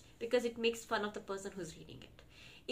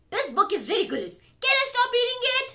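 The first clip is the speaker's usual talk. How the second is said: loud protest climbing high; mock exasperation; 11 semitones higher, 9 dB louder, faster than usual. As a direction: demand to stop, hammed-up. Reader fed up with book.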